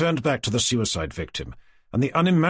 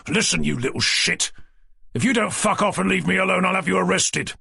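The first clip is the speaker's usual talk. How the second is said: angry threat; 6 semitones higher than usual. Acted. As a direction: snap at him, rising to a shout. Threatening armed mugger, furious.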